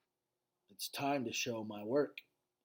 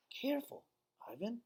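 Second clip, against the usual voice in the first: put-on much higher, soft girl's warning; a little girl gently cautioning; high, hushed child's voice; young girl; 9 semitones higher, 7 dB quieter than usual. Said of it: "Julia says".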